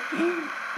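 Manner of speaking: short sharp gasp of surprise; startled gasp. Real, not acted. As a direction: gasp at the muddy car. Car still muddy, startled. (kısa, şaşkın bir nefes çekme)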